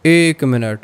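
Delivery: ordinary talk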